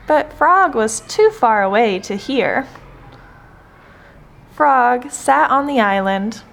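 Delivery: plain reading voice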